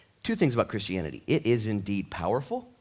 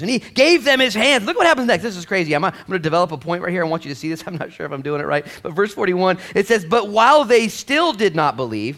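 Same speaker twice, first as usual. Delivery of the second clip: higher and louder, preaching hard; full-force preaching; 9 semitones higher, 10 dB louder than usual. Real, not acted. Pastor rousing the church, full-force preaching. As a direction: lift the room with it.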